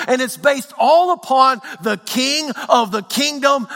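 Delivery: high, loud building sermon delivery; rising preacher's cadence, pushing up in pitch; preaching at full force. Acted. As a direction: preach it out to the whole church.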